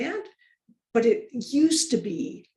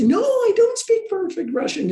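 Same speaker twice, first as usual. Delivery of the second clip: put-on high, louder line in a Russian accent; put-on Russian accent, pitched up; 11 semitones higher, 5 dB louder than usual. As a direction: protest emphatically with a Russian accent. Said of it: "I can say that with a perfect Russian accent".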